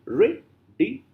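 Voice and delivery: "Radiator" in four separate rising beats; syllable by syllable. Shown as a demonstration. Teacher counting syllables for learners, syllable by syllable. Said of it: "How many syllables are there?".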